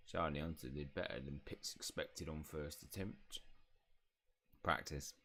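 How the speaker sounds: low, soft house elf; grudging praise in a low elf voice; Pitts. Acted. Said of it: "elf voice".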